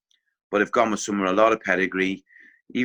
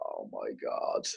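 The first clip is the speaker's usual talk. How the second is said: whispered dread; 12 dB quieter, slower than usual. Retold or imitated. Inner dread on hearing name.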